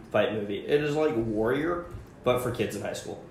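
normal talking voice

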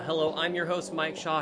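usual speaking voice

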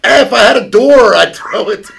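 hammed-up high, loud shrug of regret; mock Jack. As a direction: call down to someone falling. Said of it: "Would look over and go".

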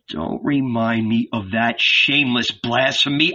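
ordinary delivery, nothing marked

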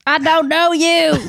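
high, slow put-on cartoon yell; cartoon quote. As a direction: draw it out in a high character voice. Quotes a King of the Hill scene.

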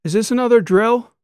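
high, loud, alarmed call from the seats; yelling in sudden worry; shouted question. A narrator's reading. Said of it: "one of the tourists shouted".

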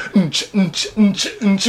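mouthed dance-music thump; beatbox a simple club beat; club beat noise. Pretend. Imagining you are dancing high in the club, mouthed beat. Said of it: "like, dancing".

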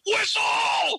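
very high, angry coach's shout; angry scream. Retold or imitated. Coach angrily stopping a play, screaming. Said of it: "they always do it kinda angry".

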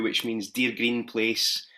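ordinary talk, nothing marked

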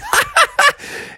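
put-on very high kid giggle; acted laughter. Boy laughing at the broken wall, gleeful. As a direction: cackle high and fast. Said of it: "And Froggy was like".

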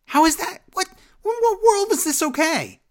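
high incredulous cry of disbelief; stunned, appalled at the song; outraged disbelief. Real, not acted. To co-host, appalled at the theme song, outraged disbelief.

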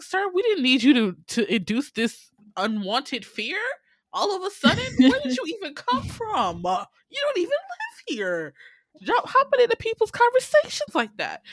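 high-pitched indignant rant, climbing as it goes; indignant telling-off. Retold or imitated. Viewer scolds the tramp for butting in, high mock indignation.

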